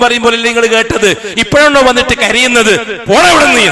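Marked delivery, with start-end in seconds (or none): 1.4-3.7 angry dismissal. Acted. Preacher voicing God driving sinners away, loud angry shout. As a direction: drive them away with a furious shout. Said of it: "എന്ന്".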